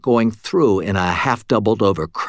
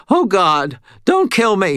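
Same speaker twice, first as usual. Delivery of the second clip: terrified plea; 11 semitones higher, 5 dB louder than usual. A narrator's reading. Terrified cashier pleading.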